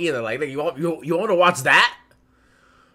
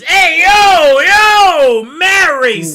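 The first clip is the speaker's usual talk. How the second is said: very loud, much higher outraged yell; New York dub shout; 6 semitones higher, 9 dB louder than usual. Pretend. Friend acting a New York dub character shouting, mock outrage. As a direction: shout it like an offended New Yorker. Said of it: "New York version".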